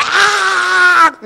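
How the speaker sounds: loud high-pitched comic scream; frantic inner scream. Acted. Little man in his head losing it, comic scream. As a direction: let out a hammed-up panicked yell. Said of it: "little man in my head is going".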